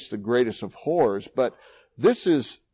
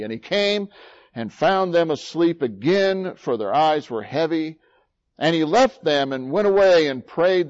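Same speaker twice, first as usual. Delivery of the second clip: raised, building preacher's reading of the verses; impassioned scripture reading; 4 semitones higher, 5 dB louder than usual. Acted. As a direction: read it like the Lord's own plea, slowing on the prayer. Reads scripture with rising force.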